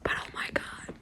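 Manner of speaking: hushed excited whisper. Real, not acted. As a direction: whisper it so no one hears. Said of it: "I have to whisper".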